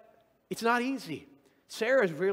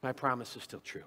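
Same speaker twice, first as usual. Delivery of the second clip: tender God; 5 semitones lower, 5 dB quieter than usual. Retold or imitated. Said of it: "But God says".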